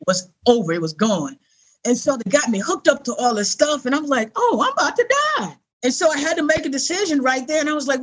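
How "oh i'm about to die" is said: much higher, startled dawning fear; alarmed realization. Retold or imitated. Patient on a gurney realizing he is dying, high and startled. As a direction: it just hits you.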